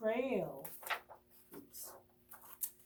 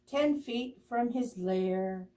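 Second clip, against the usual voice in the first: usual speaking voice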